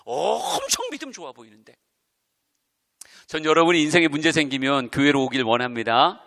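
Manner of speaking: normal talking voice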